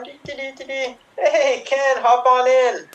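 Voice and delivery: high, sing-song Barbie pitch; playful high-pitched doll voice; Barbie calling Ken. Acted. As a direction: hum along, then call out high to a friend.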